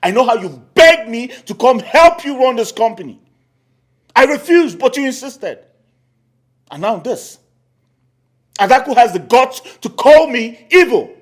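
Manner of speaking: indignant rant. Acted. Uncle rants to sister.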